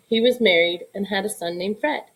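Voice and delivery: usual speaking voice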